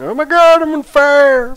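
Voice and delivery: high, loud hammed-up panic; mock panicked screaming. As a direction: scream like a crowd on fire, playfully. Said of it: "people running around screaming".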